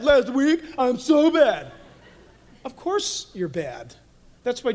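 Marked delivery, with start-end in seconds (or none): 0.0-1.7 hammed-up guilt. Mock confession continues.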